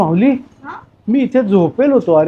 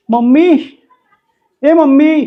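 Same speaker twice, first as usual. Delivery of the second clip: louder, higher call across the house; 7 semitones higher, 4 dB louder, slower than usual. Acted. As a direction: shout for mom who is out of sight. Child calling for mom. (आईला मोठ्याने हाक)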